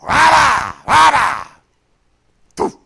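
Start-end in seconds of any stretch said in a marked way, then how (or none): 0.0-1.5 loud revving engine roar, rah-rah; shouted imitation of a loud exhaust. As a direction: rev the engine loud and hard. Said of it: "dual exhaust and loud".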